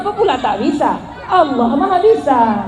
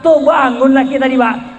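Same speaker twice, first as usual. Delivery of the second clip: ordinary delivery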